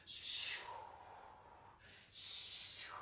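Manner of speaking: long, airy whoosh; witches flying. Pretend. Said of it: "pretend to be witches flying".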